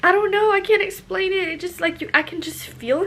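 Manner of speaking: high, loud and giddy; overwhelmed. Real, not acted. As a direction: gush because you can't find words. Overwhelmed describing baby moving.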